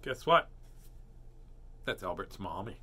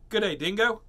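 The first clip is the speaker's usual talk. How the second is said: loud, high attempt at Australian; bad Aussie storybook greeting; hammed-up, poor Australian accent; terrible Australian accent; 7 semitones higher, 6 dB louder than usual. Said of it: "in a fairly terrible Australian accent".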